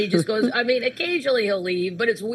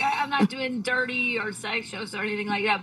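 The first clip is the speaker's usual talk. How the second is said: ordinary delivery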